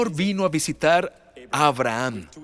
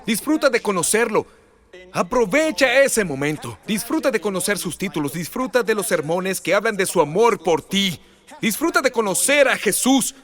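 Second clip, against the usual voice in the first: fervent preaching; 5 semitones higher than usual. Acted. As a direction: urge the church to enjoy the Lord. Preaching at full force.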